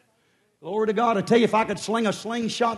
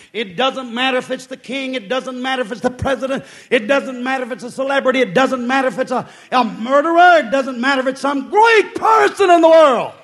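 rhythmic pulpit list swelling to a shout; building preaching; 3 semitones higher, 9 dB louder than usual. Acted. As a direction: hammer each repetition, louder toward the climax. Preacher builds repeated phrase to climax.

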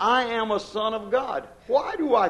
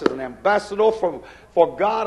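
ordinary talk

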